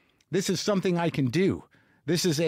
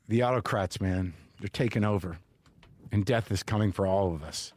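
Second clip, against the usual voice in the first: low, subdued doom; gloomy resignation; 8 semitones lower than usual. Real, not acted. Comic remembering autocrats are taking over, gloomy resignation. Gloomy deflation after the rant.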